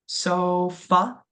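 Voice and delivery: sofa with a clear SO and a short weak uh at the end; schwa ending. Shown as a demonstration. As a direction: repeat sofa as a model. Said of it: "make that uh sound".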